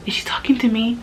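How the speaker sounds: unvoiced, breathy aside; whisper. Thinking about the boss's bossy text, whispered. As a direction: whisper it to yourself in shock. Private shocked thought.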